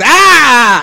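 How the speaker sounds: loud high fake scream. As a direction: scream like a bratty kid. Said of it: "He just".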